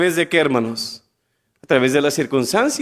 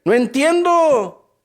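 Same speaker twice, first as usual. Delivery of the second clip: higher, slow, baffled plea; puzzled eunuch; 7 semitones higher, much slower than usual. Acted. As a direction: drag it out, lost and confused.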